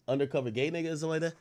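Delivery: ordinary talk, nothing marked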